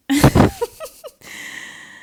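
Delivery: high, loud burst of laughter; real laugh. Real, not acted. Teacher laughing at her own muddle, high burst.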